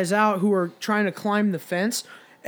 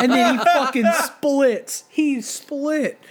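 laughing; 7 semitones higher, 4 dB louder, slower than usual. Real, not acted. Laughing while telling the story.

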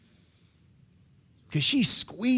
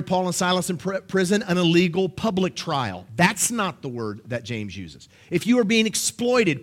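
ordinary talk

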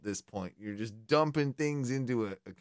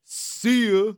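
cocky exit; 8 semitones higher, 6 dB louder than usual. Pretend. Acted exit line.

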